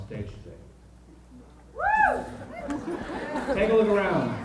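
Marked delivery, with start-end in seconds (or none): 1.7-2.2 high, loud cheering whoop; excited whoop. Real, not acted. Hyping up the freshman crowd. 3.5-4.4 loud, raised call to the crowd; booming rally call. Real, not acted. Rallying speech to the auditorium.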